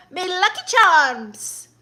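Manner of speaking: high, loud, drawn-out mock Irish catchphrase; hammed-up bad Irish, stretched out; terrible Irish accent. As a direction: put on a badly faked Irish accent. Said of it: "It's a terrible Irish accent".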